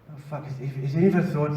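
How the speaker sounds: ordinary talk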